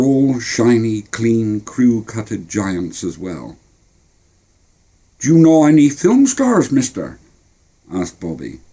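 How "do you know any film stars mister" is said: put-on high, curious young boy; eager kid voice. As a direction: ask it wide-eyed. Boy asks.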